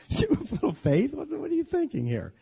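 Jesus laughing and teasing a soaked Peter. Acted. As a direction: tease him through a laugh like a headlock noogie. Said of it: "noogied his head, and said".

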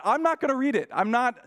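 high, loud insistence; emphatic refusal. Retold or imitated. Preacher telling the note woman loudly he won't read it. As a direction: shut it down fast.

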